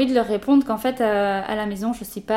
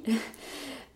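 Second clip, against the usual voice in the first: soft laugh; 5 semitones higher, 7 dB quieter than usual. Real, not acted. Laughs at her own remark.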